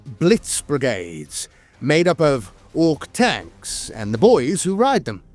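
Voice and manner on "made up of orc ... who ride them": usual speaking voice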